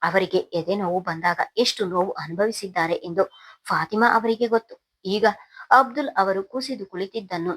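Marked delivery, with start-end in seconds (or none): none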